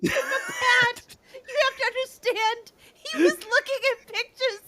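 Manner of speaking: very high, tearful laughing explanation; laughing plea. Real, not acted. Explaining the pictures to Pat, helplessly laughing. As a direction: plead squeakily through helpless giggles.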